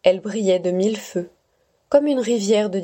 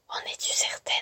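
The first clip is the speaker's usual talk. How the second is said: soft unvoiced whisper; 10 dB quieter than usual. Mysterious voice questioning Stella in the dark, whispered. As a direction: breathe the question like a hidden voice. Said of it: "chuchota une voix".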